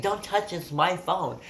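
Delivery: high, loud mock scolding; indignant grandma. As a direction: imitate a grandma loudly warning you off. Grandma guarding the phone she holds.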